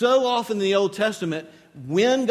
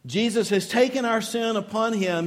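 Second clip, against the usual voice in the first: ordinary talk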